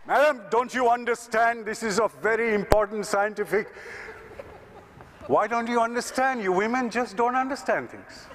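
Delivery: high, exasperated lecturing; exasperated scientists. Retold or imitated. Scientists scolding the woman, high and exasperated. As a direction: talk down to her, fed up.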